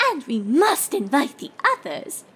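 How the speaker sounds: lightly voiced refined lady; a hint of posh mare; Rarity voice. A narrator's reading. Rarity character voice.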